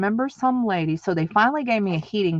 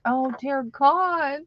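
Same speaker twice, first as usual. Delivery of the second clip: slow, higher, dismayed "Oh, dear God"; dismayed groan; 7 semitones higher, much slower than usual. Retold or imitated. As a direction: stretch it out in disbelief. Told to push out placenta.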